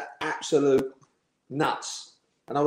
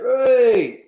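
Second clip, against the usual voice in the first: high, loud, long-held whoop; loud drawn-out mock cheer; retold group cheer; 11 semitones higher, 9 dB louder than usual. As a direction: send up the lads' jeering cheer. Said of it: "like that".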